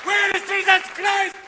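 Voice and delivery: slow, high, loud demand. Acted. Bin Laden demanding to see Jesus, high and slow. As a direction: insist on it, drawn out. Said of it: "he's going".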